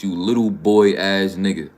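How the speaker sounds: usual speaking voice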